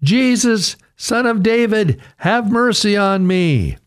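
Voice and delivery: high, pleading call for mercy. A narrator's reading. Blind beggar calling out to Jesus, high and pleading. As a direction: cry out to Jesus as he passes. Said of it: "He called out".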